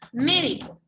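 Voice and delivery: "Médico" with MÉ punched; stress on first syllable. Shown as a demonstration. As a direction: hit the first syllable of "Médico" harder, then let the rest fall. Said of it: "ahí está la mayor fuerza de voz".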